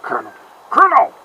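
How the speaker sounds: questioning echo, then a sudden high shout; Solid Snake impression. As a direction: repeat it back with a rising question. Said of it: "that's how you do a solid Snake impression".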